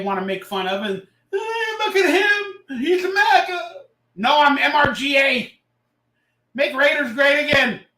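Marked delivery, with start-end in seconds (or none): 1.3-3.8 high, slow sneering mock of a critic; drawn-out high mocking jeer. Mocking critics who call him maga. 4.2-7.8 louder, higher, proud slogan; proud retort. Real, not acted.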